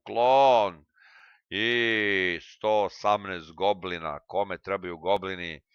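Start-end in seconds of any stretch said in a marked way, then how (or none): none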